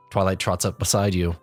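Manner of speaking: plain reading voice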